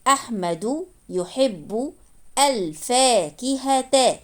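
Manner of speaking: word-by-word, slow and measured, pausing between words; slow reading demo. Shown as a demonstration. Teacher reading the finished sentence to learners, slowly and carefully. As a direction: read it out slowly with every ending sounded.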